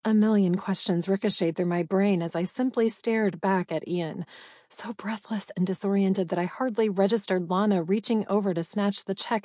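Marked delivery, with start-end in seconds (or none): none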